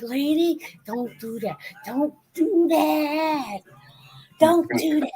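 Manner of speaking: high, drawn-out whine; imitated pleading, stretched out slowly; mock pleading. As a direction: beg her in a hammed-up wail. Acting bellboy pleading with the manager.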